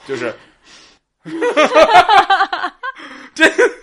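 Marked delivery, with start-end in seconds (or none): 1.2-3.7 high burst of laughter; cracking up mid-sentence; laughing hard. Real, not acted. (大笑)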